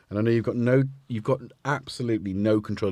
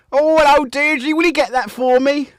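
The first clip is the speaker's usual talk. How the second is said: high, loud mock-lady falsetto; Python falsetto quote; 7 dB louder than usual. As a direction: squeal it like a Python housewife. Quoting a Monty Python sketch.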